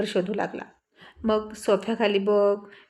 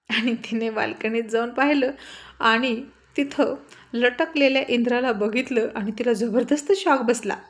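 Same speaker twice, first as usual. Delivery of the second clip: ordinary delivery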